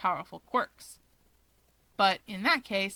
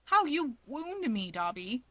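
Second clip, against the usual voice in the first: clutch your chest in mock pain; theatrical hurt; 2 semitones higher than usual. Keigo to Dabi, mock wounded and teasing.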